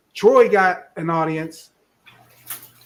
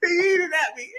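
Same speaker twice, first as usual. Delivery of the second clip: squealing laugh, much higher; 14 semitones higher, 5 dB louder than usual. Real, not acted. Laughing at frozen angry Troy, high and squealing. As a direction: laugh hard while pointing him out.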